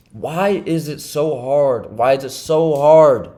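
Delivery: "so hard" drawn out slowly and hit hard; exasperated emphasis on "so hard", slow and weighty; emphatic. Real, not acted. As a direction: stretch "why" and "so hard" to drive the point home. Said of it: "emphasize this point".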